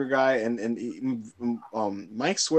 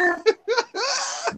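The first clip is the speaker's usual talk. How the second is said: helpless rising giggle, pitched way up; high giggling laugh. Real, not acted. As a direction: let the laugh climb out of control. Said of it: "I was dying".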